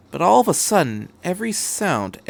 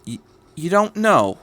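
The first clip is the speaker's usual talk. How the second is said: sharply; 6 semitones higher, 3 dB louder than usual. Acted. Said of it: "Miss Miyamura asks sharply".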